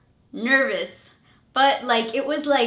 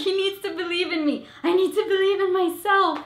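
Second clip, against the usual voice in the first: high, fast pleading self-talk; urgent self-encouragement; 8 semitones higher, much faster than usual. Retold or imitated. To herself after her boyfriend's look, high and urgent. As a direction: rush to reassure yourself, high.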